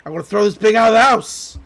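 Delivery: loud, brash character voice shouting a threat. Acted. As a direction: bellow it like a bully about to toss the pig out. Pig character threatens to throw.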